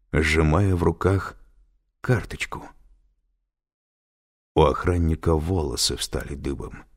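ordinary delivery

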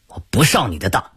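usual speaking voice

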